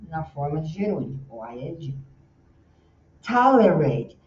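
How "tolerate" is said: Tolerate, loud, hit the first syllable; energy on the TO of Tolerate; stress on first syllable. Shown as a demonstration. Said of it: "eu tô fazendo tolerate". (ênfase na primeira sílaba de Tolerate)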